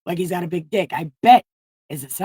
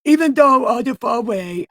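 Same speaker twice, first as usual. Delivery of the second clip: lightly voiced boastful Peter, higher and louder; mock bravado from a coward; a hint of a cowardly Peter; 5 semitones higher, 6 dB louder than usual. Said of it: "fucking Peter the coward said".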